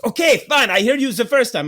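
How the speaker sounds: high, loud mock of a fed-up clerk; mock snippy clerk. Clerk brushing off the guest, a loud mock snap. Clerk voiced in retold story.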